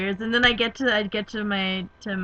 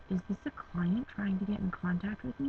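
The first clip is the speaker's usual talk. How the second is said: whispering; 8 dB quieter than usual. Retold or imitated. Dad's worried question retold.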